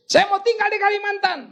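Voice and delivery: very high, wailed announcement; mock upset wife. Wife tells pastor she will move to Kalimantan, mock wail.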